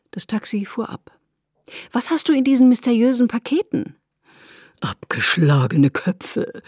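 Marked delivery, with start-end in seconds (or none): 1.9-4.0 high, louder teasing question; curious and eager. A narrator's reading. Isabel asks about mysterious parcels. 4.8-6.6 low, slow spooky voice; mock shudder. A narrator's reading. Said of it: "mit einem Schaudern".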